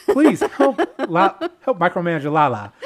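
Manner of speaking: laughing through the words; barely holding in laughter. Real, not acted. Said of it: "laughing through plea".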